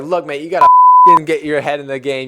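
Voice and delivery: hammed-up pep-talk yell, retold; mock coach rant. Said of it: "cussing him out".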